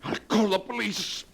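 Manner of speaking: grab at the last chance; poisoned man crying out as he reaches for the phone; desperate. Acted.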